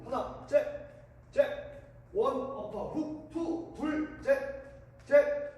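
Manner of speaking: rhythmic shouted workout calls; boxing coach calls. Shown as a demonstration. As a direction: count the punches like a coach.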